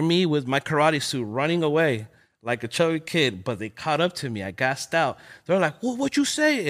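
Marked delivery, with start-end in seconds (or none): 5.8-6.7 higher mock tough-guy challenge; gang challenge. Retelling a gang stopping him, lightly voiced challenge. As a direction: toss it out like a street threat.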